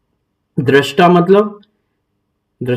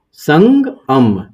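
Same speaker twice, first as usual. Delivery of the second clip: slow split syllables; much slower than usual. Shown as a demonstration. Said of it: "ये हो जाएगा संगम".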